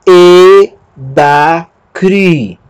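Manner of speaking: E, da, cri with gaps between syllables; E ... da ... cri, each syllable separate. Shown as a demonstration. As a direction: build it up one held syllable at a time. Teacher builds word by syllables.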